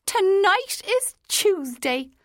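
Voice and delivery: high, slow, triumphant exclaim; triumphant cry. A narrator's reading. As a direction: crow it out in triumph. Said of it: "the woman cried triumphantly".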